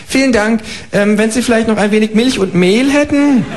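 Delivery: high, chirpy kangaroo asking for eggs. Acted. Kangaroo asking the neighbour for eggs, high and chirpy. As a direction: pipe up like a polite cheeky kangaroo.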